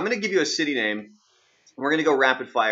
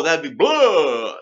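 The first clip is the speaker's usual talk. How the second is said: vampire voice; 3 semitones higher, 5 dB louder, much slower than usual. Shown as a demonstration. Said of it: "That's a vampire".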